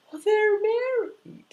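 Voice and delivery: very high, loud mock protest; scandalised objection. Client told to have tea with them, high shocked protest. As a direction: squeal it in hammed-up shock. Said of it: "And she's like".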